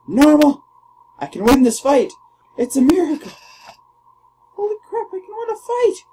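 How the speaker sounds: high, loud, rising joy; thrilled shouting. Real, not acted. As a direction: cheer as hope returns. Hits land, thrilled.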